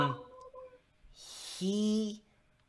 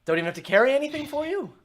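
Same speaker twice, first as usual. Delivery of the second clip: high, loud, quick character voice; pitched-up, hurried questioning; surprised stranger; 9 semitones higher, 5 dB louder, faster than usual. Acted. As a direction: voice a traveller taken aback. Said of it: "He's like".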